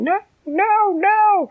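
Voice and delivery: high, loud, desperate squeak; tiny voice yelling. A narrator's reading. Ant begging the troll to stop stomping, high yell. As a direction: shriek it as a tiny ant pleading. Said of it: "Dina was yelling".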